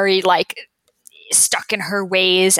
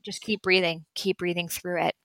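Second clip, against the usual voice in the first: calm; 3 semitones lower, 8 dB quieter than usual. Retold or imitated. Teacher calmly answers screaming woman.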